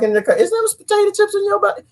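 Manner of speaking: high fussy lecture; high preachy comeback about chips and soda; mock health nut. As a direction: fuss at them high and preachy. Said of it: "I'm like".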